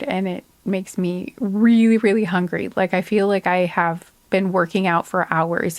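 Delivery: normal talking voice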